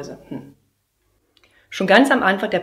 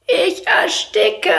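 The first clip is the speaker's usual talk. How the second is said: put-on slow, high, louder child's wail; child crying out; 12 semitones higher, 6 dB louder, much slower than usual. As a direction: whine it like a suffocating kid. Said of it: "schreit es". (gedehnt jammerndes Kind)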